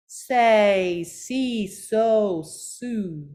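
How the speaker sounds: long open syllables, each sliding downward; relaxed-jaw exercise. Shown as a demonstration. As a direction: draw out each syllable, starting loud and easing off. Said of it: "exercises to make sure your jaw stays relaxed".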